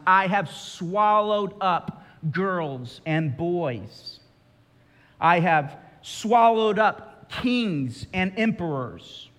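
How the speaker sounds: slow, measured lion's statement; matter-of-fact lion. Retold or imitated. Preacher voicing the lion answering Jill, slow and plain. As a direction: state it slowly, neither boasting nor sorry. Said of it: "says the lion".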